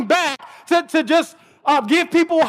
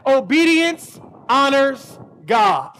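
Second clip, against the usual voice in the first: rewardsではなく、ゆっくり一語ずつ言い聞かせる; slow teaching line; slower than usual. Retold or imitated. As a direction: spell out each word to a child. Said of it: "I said this".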